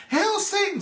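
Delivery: high-pitched imitated fan cry; mock crowd yell. Imitating the call at a show change, mock yell. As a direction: call it out high without real volume.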